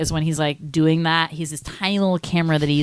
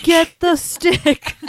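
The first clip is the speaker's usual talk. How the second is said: quoting Austin; 11 semitones higher, 4 dB louder, much slower than usual. Retold or imitated. Friend repeats movie quote.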